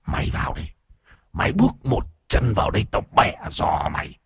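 low-volume rasping threat; menacing ghost voice. A narrator's reading. Possessed man threatens to break his challenger's legs, hoarse growl.

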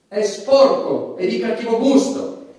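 ordinary delivery

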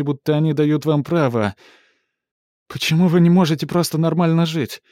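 bitter woman lashing out loud; furious actress. Acted. Ivonne's bitter rant.

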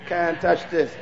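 mean it this time; soft but sure, showing how to say it with belief; like you believe it. Shown as a demonstration. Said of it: "Now say it like you believe it".

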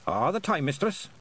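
lightly Scottish pixie voice; cheerful little Scots pixie. Acted. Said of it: "said the pixie".

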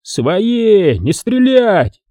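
high, slow shouted warning. Acted. Soldier shouting to his comrades not to shoot. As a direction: call out so your own side holds fire. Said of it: "крикнул".

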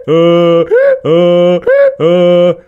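high, very loud repeated bray; loud donkey bray. A narrator's reading. Лопух the donkey lifts his head, braying loudly. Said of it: "издал громкое".

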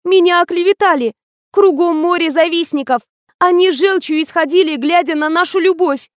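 high, wailing self-defence; acted distraught outcry; tearful protest. A narrator's reading. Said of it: "зарыдала Лена".

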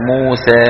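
موسى with the final alif leaning toward ي; Cairene-style imala on the alif; Egyptian tilted alif. Shown as a demonstration. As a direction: demo the alif bending to an ee sound. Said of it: "خلط صوتها بشيء من صوت الياء".

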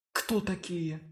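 soft musing undertone; quiet inner voice. Acted. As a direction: think it half aloud. Olesya's inner thoughts.

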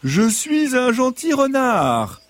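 high, slow, sugary fox; a fox selling his kindness. Acted. Fox insists he is nice.

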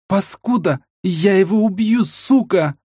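high-pitched enraged cursing; furious threat. A narrator's reading. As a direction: rage at the one who cheated you. Furious threat while smashing furniture.

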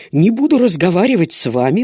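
loud, high indignant outburst. A narrator's reading. Servant outraged at being told to keep silent, indignant. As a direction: bristle at the very idea. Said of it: "возмутился Пауло".

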